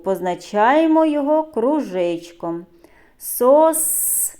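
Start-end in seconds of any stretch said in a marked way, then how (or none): 3.2-4.3 "Сосни" broken after a long middle "с"; sounding out S. Shown as a demonstration. Teacher isolating the third sound, "Сосни" with stretched "с". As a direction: hold and repeat the middle "с" in "Сосни". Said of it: "Звук с".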